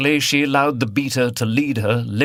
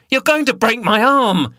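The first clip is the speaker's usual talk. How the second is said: high, loud pained scream; 13 semitones higher, 5 dB louder than usual. A narrator's reading. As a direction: scream out in pain and fury. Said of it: "she screamed".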